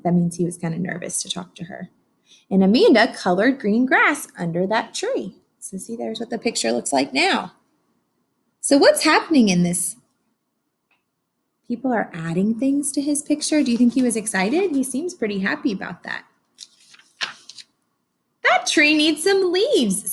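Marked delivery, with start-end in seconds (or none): 2.5-5.4 lively read-aloud storytelling; story narration. A narrator's reading. Teacher reading to her class, animated storybook narration. 18.4-20.1 put-on child character voice. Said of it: "said Ryan".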